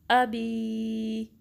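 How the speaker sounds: अबी with a crisp hamza on अ, said very slowly; sharp hamza, drawn out. Shown as a demonstration. Teacher recites अबी from Surah Masad for students. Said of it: "उसको sharply पढ़ना है".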